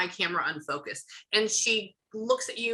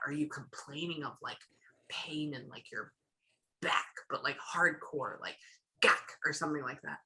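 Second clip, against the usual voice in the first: whisper; 5 semitones lower, 8 dB quieter than usual. Real, not acted. Asking a hushed question.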